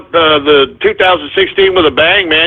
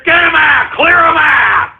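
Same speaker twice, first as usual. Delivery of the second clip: shouting; 7 semitones higher than usual. Real, not acted. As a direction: bark it at the engineer. Host yells at engineer to cut calls.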